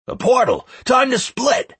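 high, urgent warning shout. A narrator's reading. Plinto alerting players to a portal, shouting. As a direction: yell it to everyone nearby. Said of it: "Plinto's shout came from nearby".

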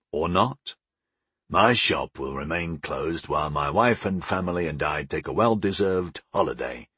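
low, lightly voiced dad; measured and set on his decision; defiant father. A narrator's reading. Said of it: "he said defiantly".

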